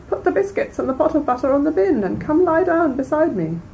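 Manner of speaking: put-on wolf under the covers luring the girl; wolf as grandmother. A narrator's reading. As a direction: lure her in sweetly as fake grandma.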